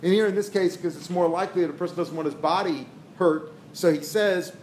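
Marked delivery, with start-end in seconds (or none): none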